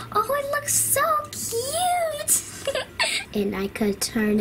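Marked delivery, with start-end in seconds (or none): 0.1-2.7 high, slow cooing over something cute; delighted cooing. Acted. Admiring her own cat. 2.8-3.3 a short high giggle of delight. Acted. Giggle at the cute cat.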